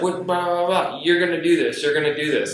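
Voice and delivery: high, mocking blah-blah voice; imitated insistent coworker. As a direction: mimic her pushing the order through.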